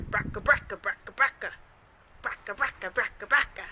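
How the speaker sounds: rattling bracka engine noise; a clattering old car engine; old car rattle. Shown as a demonstration. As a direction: sputter it like an old jalopy. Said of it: "Old cars sound like this".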